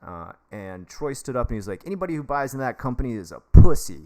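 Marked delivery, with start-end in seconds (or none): 1.8-4.0 retold blunt shout, much louder; loud outburst. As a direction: bark it out in front of the whole room. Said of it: "He just said it real loud".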